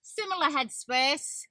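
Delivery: ordinary talk, nothing marked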